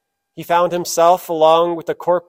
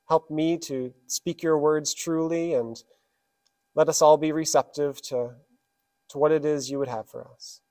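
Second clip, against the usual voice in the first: prayer; 3 dB quieter than usual. Real, not acted. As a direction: pray quietly and humbly for the congregation. Leads the congregation in prayer.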